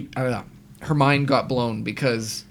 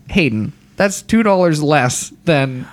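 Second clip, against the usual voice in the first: slower, louder mock protest; exasperated retort; 7 dB louder, slower than usual. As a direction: argue the price, slowly. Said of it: "I'm like".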